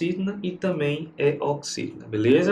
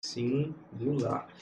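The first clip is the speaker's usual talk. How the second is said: slow, softer syllable-by-syllable word; broken into syllables; 9 dB quieter, much slower than usual. Shown as a demonstration. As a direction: spell it out slowly in syllables. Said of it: "está no singular".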